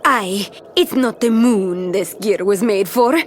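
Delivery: usual speaking voice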